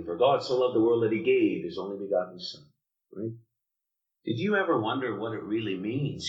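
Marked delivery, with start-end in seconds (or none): 0.0-2.7 fast, rushed recitation; preacher mimics how people rush the verse, fast and glib; rattled off quickly. Shown as a demonstration. Tossing off a memorized verse. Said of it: "But we say it so quickly".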